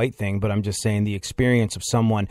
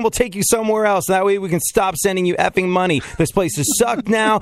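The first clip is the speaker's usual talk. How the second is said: Sam Kinison rant, toned down; 9 semitones higher than usual. Retold or imitated. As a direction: rant it like Kinison but don't fully yell. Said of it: "I can't do the full Sam Kinison bit".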